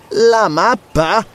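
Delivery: high, drawn-out question; stretched, astonished query; startled exclamation. A narrator's reading. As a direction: exclaim in shock, pitch rising. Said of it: "esclamò Zarono irrigidendosi".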